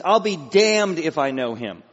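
loud, slow emphatic oath; self-cursing oath. Acted. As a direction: swear it forcefully and deliberately. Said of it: "that's a self-curse".

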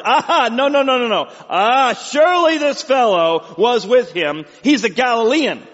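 loud, high, triumphant accusing; confident accusation. Acted. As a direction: proclaim it with hammed-up certainty. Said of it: "confidently affirmed".

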